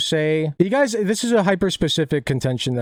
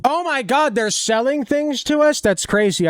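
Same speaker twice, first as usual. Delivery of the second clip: mock surprise; 10 semitones higher than usual. Sarcastic mock astonishment.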